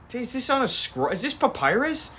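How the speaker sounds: a cop who can't believe it's papyrus; stunned mock-cop pitch jump; incredulous high cop.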